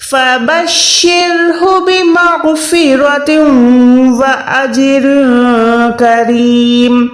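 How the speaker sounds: slow, measured tajweed recitation; slow, chanted Quran reading. Shown as a demonstration. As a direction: recite the verse in full. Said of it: "پوری آیت کیسے پڑھیں گے".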